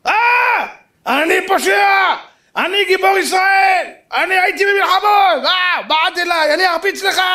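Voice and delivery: high, drawn-out furious yell, hammed up; outraged shouting. Outraged kibbutznik brother threatens the speaker, hammed-up shouting.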